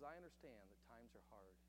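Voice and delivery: soft, low, gentle reassurance; a farmer gently comforting a desperate thief; kind voice. Acted. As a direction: speak to the thief with kindness. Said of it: "in a kind voice".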